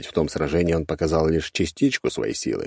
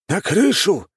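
high, urgent command; calling out; 5 dB louder, slower than usual. A narrator's reading. Old master sends the others to the roof, calling out. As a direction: call out the order in a raised elder's voice.

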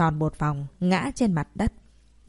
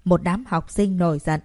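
ordinary delivery

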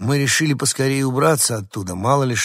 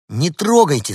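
high, loud warning cry; shout; 7 semitones higher, 7 dB louder than usual. A narrator's reading. Girl warns the dogs off the rat, shouting. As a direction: shout to stop them. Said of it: "снова крикнула рыженькая".

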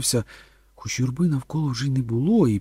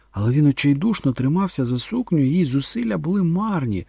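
ordinary delivery

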